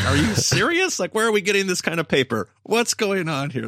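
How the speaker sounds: high, loud exasperated disbelief; mock freak-out. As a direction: act out freaking over the paper. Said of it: "for me to freak out about it".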